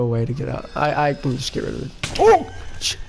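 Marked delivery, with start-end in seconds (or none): 2.2-3.0 sharp high yelp; sudden high squeal; startled yelp. Real, not acted. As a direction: jolt of real fright. Sudden fright in game.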